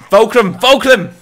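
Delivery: high, loud triumphant call; loud name shout. Real, not acted. Gamer recognising the merchant, shouts his name. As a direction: bellow the name in delight.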